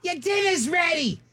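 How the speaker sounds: high, drawn-out mock household call; slow, high-pitched holler, played for laughs; calling to dinner. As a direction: imitate someone calling across the yard. Parent calling dad in for dinner.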